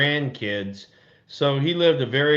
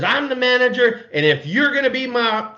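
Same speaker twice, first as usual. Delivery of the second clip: hammed-up stern skipper, pitched way up; stern manager; 9 semitones higher than usual. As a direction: play the boss putting the rookie in his place. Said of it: "so he says".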